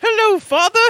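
very high little creature voice; tiny high-pitched talking blob; Flubber. Shown as a demonstration. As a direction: squeak it like a newly created goo creature. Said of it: "Can I get that "Hello, Father"?".

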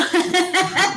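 sudden burst of laughter; loud laugh. Real, not acted. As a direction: break out laughing loudly. Burst of laughter mid-argument.

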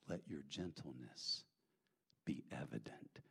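very soft, low and hushed; quiet, low-pitched reassurance; gentle. Real, not acted. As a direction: let it settle softly on the listeners. Gentle repeat of the verse.

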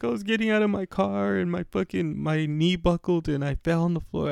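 high, drawn whimpering voice; mock a weepy tale of woe; retold crying. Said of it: "she's fucking crying".

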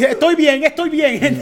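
high-pitched mimicry of the injured man; imitating fallen man. Host mocking a man lying on the floor after falling, high imitation. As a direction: insist you're fine, mimicking in a high voice. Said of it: "Él se cayó y está tirado en el piso así".

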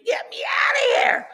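very high, loud imitated scream; screaming impression. Old lady on the windshield, screaming impression. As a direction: mimic a panicked movie scream, shrieked high. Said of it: "falls on the windshield screaming".

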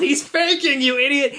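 high-pitched outraged warning; mock yell at the screen, pitched high; retold yell. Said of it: "I yelled".

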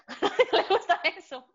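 high, laughing repeat. Real, not acted. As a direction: break into a laugh and squeal it up.

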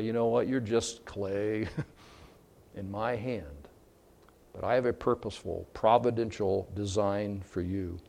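a hushed, fatherly reassurance; gentle voice of God. Acted. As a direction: speak as God to the clay, gently. Voicing God tenderly.

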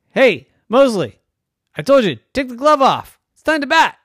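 high, loud impatient coach; scolding coach. Acted. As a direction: tell Mosley off from the dugout.

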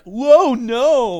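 high loud whoa no; mock alarmed shout. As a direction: yelp as the fish bites. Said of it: "he's like".

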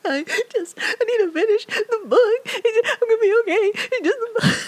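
very high, loud, crying voice; sobbing. Retold or imitated. Reader sobbing to her classmate over a book, high and crying. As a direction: blubber it through tears, half laughing at yourself. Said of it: "I'm obviously a wreck".

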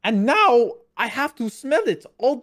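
playful fake German read ending as a question; German accent. Shown as a demonstration. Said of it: "That's pretty good".

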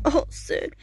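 high, weak mock moan; imitated wounded stumbling. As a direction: ham up being hurt while staggering. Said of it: "he's stumbling down the stairs".

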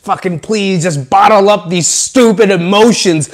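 loud, straining rant that piles up; venting outburst. Acted. Bottling up stupid emotions into a fireball, loud pent-up venting. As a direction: force each complaint out as if squeezing it tight.

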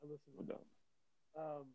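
muffled, dropping out; muffled bass only. Real, not acted. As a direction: sound like a bad muffled mic line. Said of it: "you sound a little muffled".

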